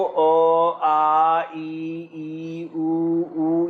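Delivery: each vowel drawn out slowly, one by one; teacher sounding out isolated vowels; vowel sounds demonstrated. Shown as a demonstration. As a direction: hold अ, आ, ई, उ, ऊ as separate pure sounds. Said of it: "vowel sound की बात करें".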